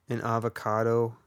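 ordinary talk, nothing marked

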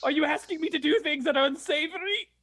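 high mock groveling; pathetic captive. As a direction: grovel eagerly in a hammed-up whine. Keeps up pathetic act to captors.

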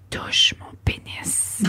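slow breathy whisper into the ear; sultry whisper. Retold or imitated. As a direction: whisper it slowly and seductively. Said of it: "je lui ai dit dans l'oreille avec une voix sensuelle".

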